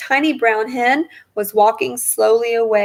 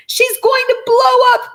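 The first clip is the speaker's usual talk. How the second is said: much higher, louder panicked wail; 6 dB louder than usual. A narrator's reading. Wife panicking as grandma steams, frightened wail. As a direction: wail in alarm. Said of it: "Missus Cranky wailed".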